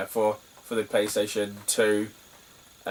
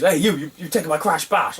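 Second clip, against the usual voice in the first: accusing seller; 6 semitones higher, 3 dB louder than usual. Retold or imitated. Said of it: "And he goes".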